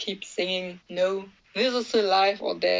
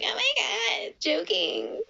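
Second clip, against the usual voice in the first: high, hammed-up fake disbelief; mock surprise; 11 semitones higher than usual. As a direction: squeal it like you don't mean it. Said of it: "you're like".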